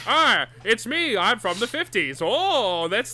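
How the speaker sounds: put-on old-timey radio voice; b-movie acting; 1950s announcer voice. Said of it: "nineteen fifties voice effect".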